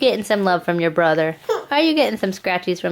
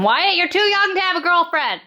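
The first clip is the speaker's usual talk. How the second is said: calling out; 10 semitones higher than usual. Real, not acted. Calling to son in other room.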